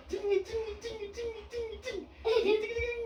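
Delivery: very high squeaky cing; high squeak. Pretend. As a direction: squeak like a tiny toy. Bunyi lucu bernada tinggi. (cicit nada sangat tinggi)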